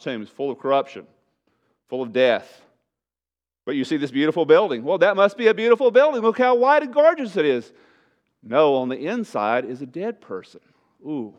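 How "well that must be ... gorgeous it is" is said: high, loud gushing; mock admiration. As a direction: gush like an awed onlooker, voice soaring. Voices an admirer of whitewashed tombs.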